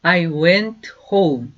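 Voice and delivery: slow, clear teacher's model, each word apart; slow demo pronunciation; slow model sentence. Shown as a demonstration. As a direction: say it slowly for students to repeat. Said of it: "다시요".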